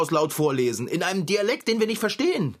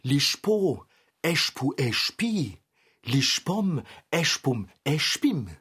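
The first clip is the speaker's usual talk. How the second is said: put-on Swiss accent; slower than usual. Swiss German accent, reading the spell to undo the magic. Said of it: "mit Schweizer Akzent lesen".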